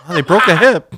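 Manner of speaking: laughing, high and loud. Pretend. Cohost joking about the old ladies falling, laughing. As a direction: cackle out the punchline.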